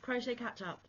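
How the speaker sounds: ordinary delivery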